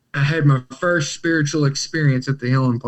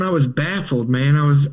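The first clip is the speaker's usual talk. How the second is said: ordinary delivery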